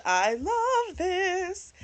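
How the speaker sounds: high, slow, drawn-out squeal of delight; swooning over the twist; gushing delight. Retold or imitated. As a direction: stretch it out like a giddy fan. Own reaction to plot twist.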